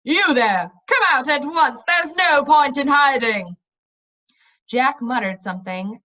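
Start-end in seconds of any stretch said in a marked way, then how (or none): 0.0-3.5 loud summons from outside; commanding shout. Acted. A stranger outside shouting at the hiding travellers, loud. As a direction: bellow at them to come out. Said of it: "A shout from outside".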